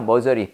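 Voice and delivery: "머저리", meo-jeo-ri, for misery; misreading of misery. Retold or imitated. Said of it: "그냥 읽으시면 머저리로 읽히죠".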